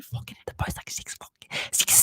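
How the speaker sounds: hushed aside, breath only; whisper. Real, not acted. Streamer to his viewers, griping about the post in a whisper. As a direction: lean in and whisper it like a secret.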